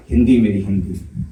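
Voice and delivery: ordinary talk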